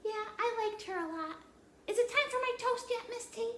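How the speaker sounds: put-on high, chirpy little girl; child puppet voice. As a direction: play the curious puppet child. Puppet Annie voice.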